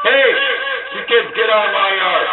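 put-on high, cranky old man yelling; grumpy old man. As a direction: shoo the kids off his lawn. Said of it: "I used to do that with echo mic mode".

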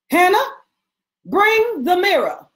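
high and loud, calling across the room; calling out sharply. Acted. Mistress calling her servant for the mirror, loud and high. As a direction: summon the servant at once.

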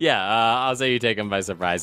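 ordinary talk, nothing marked

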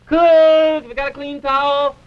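high, loud, slow call. Acted. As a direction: call out for a towel. Calling out from the tub.

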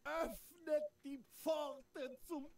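biblical prophet. Acted. Invokes gods of forefathers.

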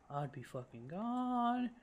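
slow, climbing high on "gone"; comically stretched, rising emphasis; exaggerated insistence. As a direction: hold "gone" long and high. Exaggerates how fast he would flee.

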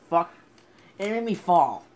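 loud furious yell; angry shout. Real, not acted. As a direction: rage at the game, yelling. Gamer raging after falling.

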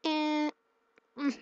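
usual speaking voice

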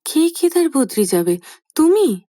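jolted mother, rising on তুমি; mother startled by her son's plan. A narrator's reading. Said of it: "চমকে ওঠেন ত্রিনয়নী".

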